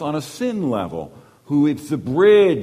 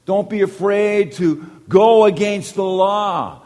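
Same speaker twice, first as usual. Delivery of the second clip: loud, slow, higher proclamation; emphatic angel; 6 semitones higher, 6 dB louder than usual. Retold or imitated. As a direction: announce it like a heavenly messenger. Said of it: "we're told".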